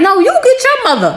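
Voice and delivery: high-pitched retold back talk; mock sassy comeback. Kid talking back to mom, mock sassy. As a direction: toss it back at mom, playfully indignant.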